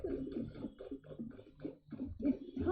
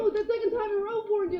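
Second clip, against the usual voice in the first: fast, higher and louder double take; retold surprise; 6 semitones higher, 6 dB louder, faster than usual. Host retelling her shock at two forties, quick and excited. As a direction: blurt it out like you just caught on. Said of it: "and then I realized".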